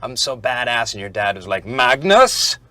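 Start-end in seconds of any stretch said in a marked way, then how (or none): 1.7-2.6 put-on loud, overdone Norwegian accent; imitating a dad in a bad Norwegian accent. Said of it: "That was the worst Norwegian accent ever".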